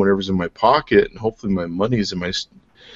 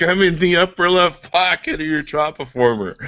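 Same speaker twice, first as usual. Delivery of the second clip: higher-pitched voice assistant impression; Siri; 8 semitones higher than usual. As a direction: answer as a phone's virtual assistant. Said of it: "She's like".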